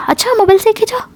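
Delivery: high, quick disbelieving question; sceptical challenge. Retold or imitated. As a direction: throw the excuse back as a doubting question. Friend challenges the excuse just given. (शक भरे ऊँचे सुर में, तेज़ी से पूछते हुए)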